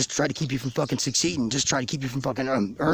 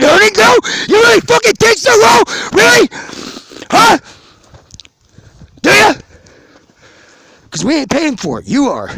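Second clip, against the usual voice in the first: yelling louder and higher, building into a roar; rising furious rant; furious shout; 9 semitones higher, 10 dB louder than usual. Real, not acted. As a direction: scream it at them in real rage. Ranting about rioters and taxes.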